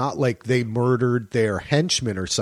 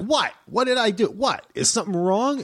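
flustered Kate; 8 semitones higher than usual. Retold or imitated. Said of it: "she's gonna be like".

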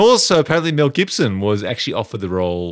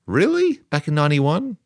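a sharp, much higher, incredulous "Really?"; surprised; 6 semitones higher than usual. Real, not acted. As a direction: pitch "Really?" way up in disbelief. Incredulous at Elizabeth Hurley casting.

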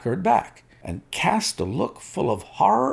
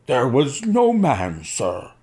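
sullen defiance; 5 semitones higher, 4 dB louder, slower than usual. A narrator's reading. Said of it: "face set in sullen defiance".

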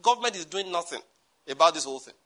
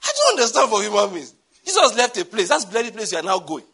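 loud, higher-pitched exasperated outburst; exasperated rant; 4 semitones higher, 6 dB louder than usual. Real, not acted. Preacher scolding pilgrims who visit the empty tomb, exasperated. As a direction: raise the voice in exasperated disbelief.

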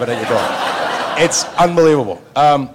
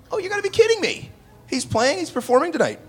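much higher, astonished; surprised. Retold or imitated. Told Robin Williams is playing tonight, high astonished disbelief. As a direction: gasp out the news in disbelief.

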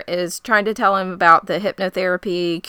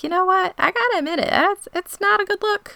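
pitched way up, wryly conceding; high mock concession; 13 semitones higher than usual. Podcaster mimics her own reaction to the governor, high mock concession. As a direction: squeak it out like a reluctant admission. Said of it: "I was like".

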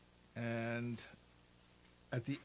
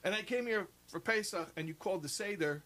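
indignant complaint; 7 semitones higher than usual. Retold or imitated. Visitor complaining to the sexton, mock aggrieved. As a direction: build the grievance, hammed-up. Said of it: "He's like".

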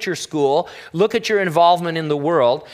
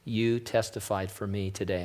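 much softer, low and tender; gently commend him like a merciful father; gracious, merciful Lord; 6 semitones lower, 10 dB quieter than usual. Retold or imitated. The Lord gently commending Gene at day's end, softly tender. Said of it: "in that beautiful, gracious, and merciful way".

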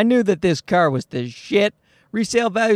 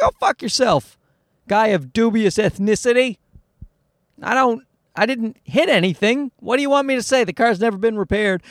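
higher-pitched, exasperated comeback; acted outrage at being accused; indignant retort; 5 semitones higher than usual. Car owner snapping back at an appraiser, acted indignation.

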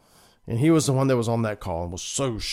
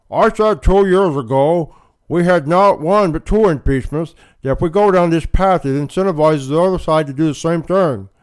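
mock McConnell; 6 semitones higher, 7 dB louder than usual. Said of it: "he noted".

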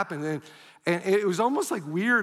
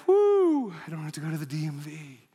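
relieved whoop; 3 semitones lower than usual. Retold or imitated. Relief at getting license back.

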